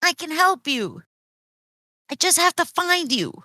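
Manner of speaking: high-pitched character voice; Leah's plant-speech voice. Acted. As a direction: promise help in a high voice. Leah reassures Bud in plant speech.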